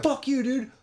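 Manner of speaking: high-pitched acted retort; mock defiant insult. One guy cursing another in the street, high mock anger. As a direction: throw it back in a high mock voice.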